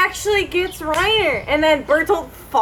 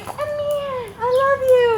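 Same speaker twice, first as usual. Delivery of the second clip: much higher, sweet mock-girly voice; girly Historia; 11 semitones higher than usual. Friend voicing Historia confessing to Ymir, mock-girly and high. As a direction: swoon it like a lovestruck schoolgirl. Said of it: "Historia's like".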